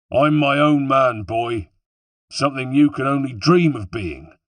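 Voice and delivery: high, scornful West Country villain; proud, taunting West Country voice; West Country accent. A narrator's reading. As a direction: belittle the younger man in West Country tones. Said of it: "He had a West Country accent".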